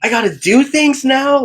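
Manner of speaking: high, loud mock alarm; high, loud mock whine about work; mock panic. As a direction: squeal it in fake dismay. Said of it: "It's like".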